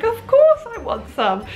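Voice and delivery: eager reply. Retold or imitated. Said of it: "And I was like".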